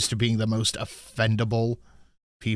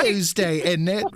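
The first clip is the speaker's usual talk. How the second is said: hammed-up British accent, pitched up; British meme impression; 7 semitones higher, slower than usual. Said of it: "that's the meme joke".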